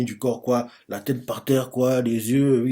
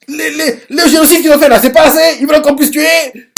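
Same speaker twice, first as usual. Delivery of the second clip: loud, very high-pitched rant; exasperated high yelling; indignant outburst; 14 semitones higher, 9 dB louder than usual. Real, not acted. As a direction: burst out in protest at the top of the voice. Storyteller rants about the characters' excess.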